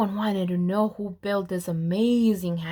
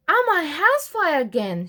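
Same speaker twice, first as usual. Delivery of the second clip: happy declaration; 6 semitones higher, 4 dB louder than usual. A narrator's reading. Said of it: "she declared".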